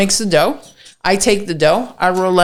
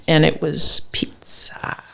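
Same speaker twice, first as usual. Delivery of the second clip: drawn-out, breathy whispered reveal; hushed reveal; 3 semitones lower, 4 dB quieter, slower than usual. Real, not acted. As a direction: whisper the last word, slowly. Reveals the dreaded final challenge.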